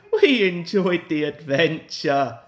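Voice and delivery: cheerful passengers laughing it off. A narrator's reading. Passengers to the apologising driver, laughing. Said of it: "they laughed".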